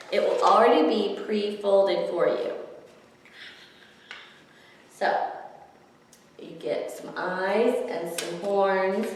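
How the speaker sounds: ordinary delivery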